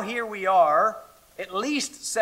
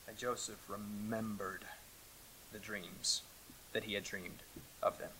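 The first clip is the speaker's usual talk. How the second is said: much softer, weighty reading; hushed emphasis; 9 semitones lower, 21 dB quieter than usual. Real, not acted. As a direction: let the verse sink in quietly. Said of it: "reads key verse softly".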